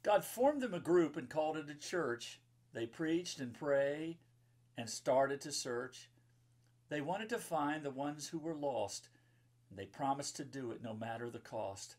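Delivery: bright, sing-song rhyming read-aloud; lilting storybook verse; reading to children. Acted. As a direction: read the rhyme to a room of kids. Reads rhyming children's book.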